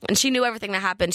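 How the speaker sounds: ordinary talk, nothing marked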